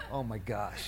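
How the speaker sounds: low, hushed mock dismay; inward dismay. Husband privately reacting to wife's remark, hushed inward dismay. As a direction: keep the reaction inside, softly. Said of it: "inside I go like this".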